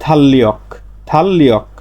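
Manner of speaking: "থাল্লিয়ক" with a long double L; correct assimilated pronunciation. Shown as a demonstration. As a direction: turn R to L in "থাল্লিয়ক". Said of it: "তাই এর উচ্চারণটা হবে". ("থাল্লিয়ক" র বদলে জোড়া ল দিয়ে)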